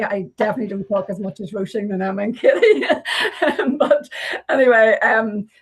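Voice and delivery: breaking into a laugh. Real, not acted. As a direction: laugh through the end of your own joke. Self-teasing joke about talkative colleagues.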